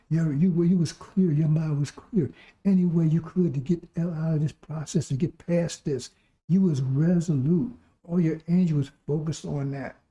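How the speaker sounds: normal talking voice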